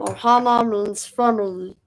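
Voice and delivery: cartoon alien creature impression; Stitch. Shown as a demonstration. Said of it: "I can do Stitch".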